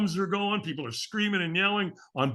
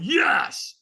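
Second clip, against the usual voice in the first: forceful yes; 8 semitones higher, 4 dB louder than usual. Retold or imitated. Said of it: "Squeeze your fist and say yes".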